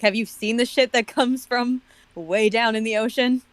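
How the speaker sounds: normal talking voice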